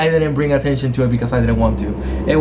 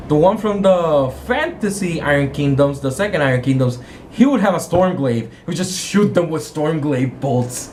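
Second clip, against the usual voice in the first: ordinary talk, nothing marked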